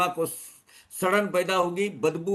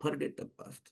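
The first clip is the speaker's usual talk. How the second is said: much softer, settled repeat; softly spoken at normal pitch; hushed reminder; 12 dB quieter, much slower than usual. Real, not acted. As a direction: let it drop to a quiet aside. Same exhortation repeated softly.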